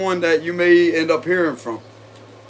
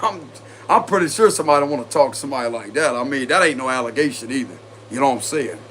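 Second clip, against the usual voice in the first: ordinary talk, nothing marked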